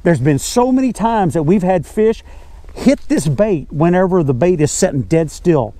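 usual speaking voice

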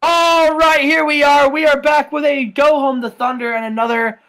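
big loud show-opening hype; booming wrestling-show welcome; announcer intro. Acted. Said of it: "opening show announcer".

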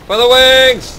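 high loud shout of "wings"; rally everyone with a yell; battle cry. Real, not acted.

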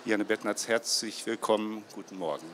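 ordinary delivery

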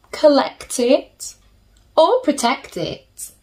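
ordinary delivery

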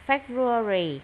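"February" run together at normal speed; "February" in one smooth go. Shown as a demonstration. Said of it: "Đọc nhanh lại".

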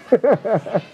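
quick amused laugh; a brief laugh at his own joke; short laugh. Real, not acted. Laugh after dog voice.

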